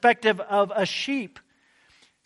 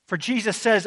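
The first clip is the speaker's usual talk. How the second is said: normal talking voice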